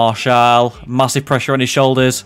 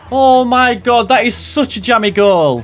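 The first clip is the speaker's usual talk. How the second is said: incredulous; 6 semitones higher, slower than usual. Real, not acted. Gamer reacting to a jammy goal, high incredulous cry. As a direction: caught off guard by a fluke.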